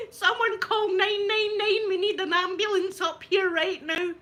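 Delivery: high, loud, slow frantic yell; panicked shouting. Retold or imitated. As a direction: beg for an ambulance, hammed up.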